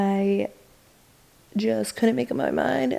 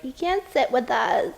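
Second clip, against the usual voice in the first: put-on mean girl voice; 6 semitones higher than usual. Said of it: "those girls that are like".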